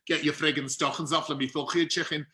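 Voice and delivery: guttural Scouse with throaty k sounds; Liverpudlian accent. Retold or imitated. Actor performing down the fallen camera's lens, guttural Liverpudlian. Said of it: "ridiculous Liverpudlian accent".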